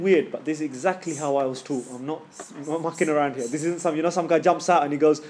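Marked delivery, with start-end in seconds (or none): none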